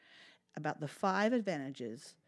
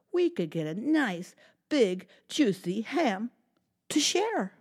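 put-on lively, animated read-aloud for kids; storytime narration; 3 semitones higher, 9 dB louder than usual. A narrator's reading. Librarian reading a story to children, animated. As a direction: tell it big and bright for little listeners.

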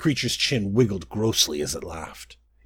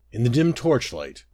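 ordinary delivery, nothing marked

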